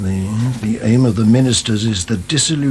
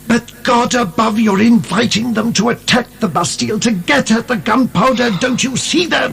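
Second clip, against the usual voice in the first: higher, exasperated protest; 9 semitones higher than usual. Acted. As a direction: argue back in disbelief. Heated argument about the gunpowder.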